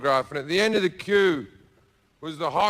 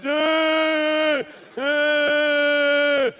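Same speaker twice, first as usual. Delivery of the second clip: high, louder mock sobbing over the hairdryer; mock crying wail; 10 semitones higher, 4 dB louder than usual. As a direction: wail it high like a hammed-up cry. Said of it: "she's crying".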